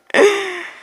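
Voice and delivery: short real laugh at herself; real laugh at her own refusal. Real, not acted. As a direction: break into a laugh. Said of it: "laughs at herself".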